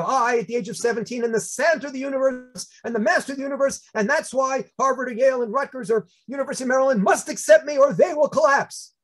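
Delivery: high-pitched bombastic declaration; grandiose self-mockery. Teen's insane college essay to admissions, grandiose mock boasting. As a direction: declaim it like an absurdly self-important teenager. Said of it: "that insane essay".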